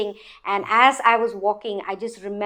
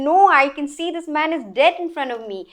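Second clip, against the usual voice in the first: higher, urgent retold protest; alarmed insistence; 6 semitones higher, 4 dB louder than usual. Witness contradicting friends who doubt the gunshot, alarmed insistence.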